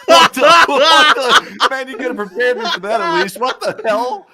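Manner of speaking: high, loud, stunned outburst; real shock. Real, not acted. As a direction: cry out in startled disbelief. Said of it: "that shocked the hell out of me".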